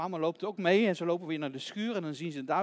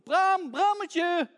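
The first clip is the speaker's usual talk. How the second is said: high, loud, slow search call; 11 semitones higher, 10 dB louder, much slower than usual. Acted. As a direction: stretch the name out as you call. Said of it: "En ze roept".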